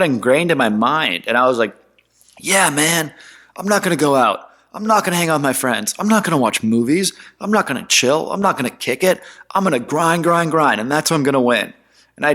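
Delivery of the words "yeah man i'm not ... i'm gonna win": higher, psyched-up self talk; lightly hammed hustle mantra; pumped-up grind vow. Retold hyped-up younger self.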